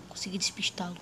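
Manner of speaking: hushed whisper while hiding; whispering to stay hidden. Acted. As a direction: breathe it out so he won't hear. Hiding from a predator.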